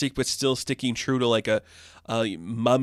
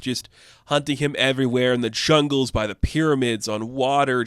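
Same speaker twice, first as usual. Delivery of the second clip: ordinary talk, nothing marked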